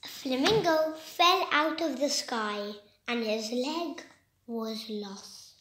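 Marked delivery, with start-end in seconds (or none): 4.5-5.6 breathed out in a whisper, almost nothing. A narrator's reading. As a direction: let the loss sink, whispering. Sad moment of loss.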